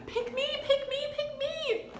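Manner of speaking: put-on very high eager classroom kid voice. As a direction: beg to be called on, squeaky high. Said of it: "who's like".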